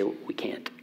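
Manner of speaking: unvoiced whisper of We can't; whispered admission of inability. Shown as a demonstration. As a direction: breathe it out, admitting defeat. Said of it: "we say".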